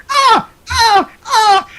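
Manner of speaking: very high squawking seagull cries; shrill mock gull calls, one after another; seagull impression. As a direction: screech like gulls in a gale. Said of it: "best seagull impression I've ever heard".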